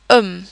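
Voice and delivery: um starting straight on the vowel; silent H. Shown as a demonstration. Teacher demonstrating French H, um with silent H. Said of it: "The H is never pronounced".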